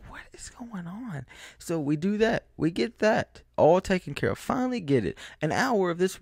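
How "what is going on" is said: slow, hushed disbelief; stunned whispered aside; bewildered whisper. Retold or imitated. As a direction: whisper it, baffled. Clerk bashing tires with club.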